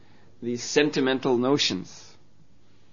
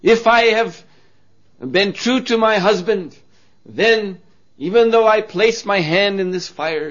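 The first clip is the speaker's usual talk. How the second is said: quivering voice; 6 semitones higher, 6 dB louder than usual. Acted. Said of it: "with a quivering voice".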